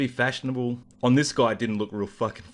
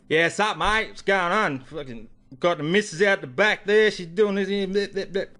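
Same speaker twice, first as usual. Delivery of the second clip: higher-pitched Australian bogan caricature; full bogan; 6 semitones higher than usual. Acted. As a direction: put on a broad bogan Aussie accent, running into babble. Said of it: "he goes full bogan".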